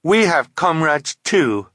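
high, loud, slow defiance; brave. A narrator's reading. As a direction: stand up to the villains bravely. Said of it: "Violet said bravely".

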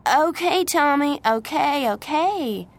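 high, loud hurried placating; exasperated giving in. Acted. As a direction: cut in to calm a shouting child. Nanny gives in to shouting boy.

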